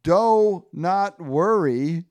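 slow, loud, drawn-out words; hammed-up reassurance, word by word; reassuring pepperoni Ernie. Ernie reassures melting Frank, slow and hammy. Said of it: "And then Ernie goes".

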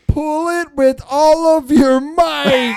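high, loud, slow mock hero voice; goofy heroic plea. Friend replays the magnet story, goofy heroic plea. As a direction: ham it up as a desperate hero. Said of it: "I wish I saw that".

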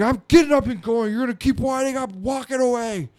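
loud, high angry threat; fed-up dad. Retold or imitated. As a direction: imitate a parent who has had enough. Acted dad threatening whining kid.

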